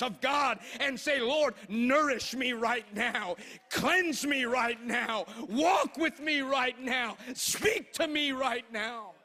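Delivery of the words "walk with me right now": usual speaking voice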